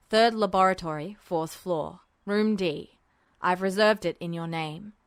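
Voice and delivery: low, hushed officer's voice; Mustang voiced low. A narrator's reading. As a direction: keep it low and secretive. Mustang's voice in story.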